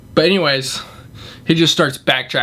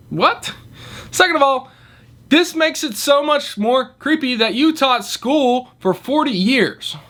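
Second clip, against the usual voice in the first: indignant disbelief; 11 semitones higher than usual. Retold or imitated. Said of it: "indignant rant at the customer".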